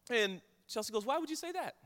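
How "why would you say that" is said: concerned mom. Retold or imitated. Higher concerned voice as wife questions her daughter. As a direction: play the worried mother, lifting up high.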